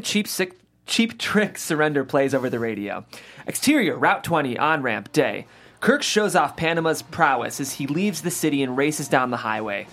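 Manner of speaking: ordinary delivery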